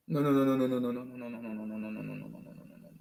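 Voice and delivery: from soft down to a whisper; fading no's. Real, not acted. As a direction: let the no's die away to nothing. Repeated no dying away.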